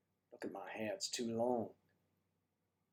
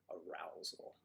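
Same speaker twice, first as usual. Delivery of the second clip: hushed whispered tease; whispered echo; 5 semitones lower, 13 dB quieter, slower than usual. Acted. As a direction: sly whisper of the word. Whispered echo of the joke word.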